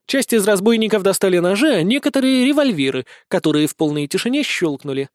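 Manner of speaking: usual speaking voice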